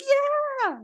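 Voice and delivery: high excited cheer; a little whoop of a yeah. Retold or imitated. Fan recalling cheering at the kiss, high joyful yeah. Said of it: "I actually cheered".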